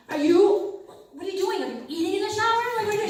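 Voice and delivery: rising outrage at a silly answer; incredulous. Retold or imitated.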